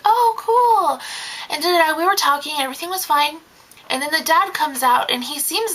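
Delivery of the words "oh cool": much higher, louder oh cool; polite enthusiasm. Retold or imitated. Nanny answering Saudi Arabia with higher polite cheer. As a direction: act pleased for the kids. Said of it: "And I was like".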